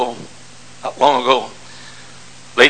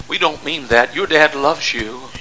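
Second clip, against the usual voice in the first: normal talking voice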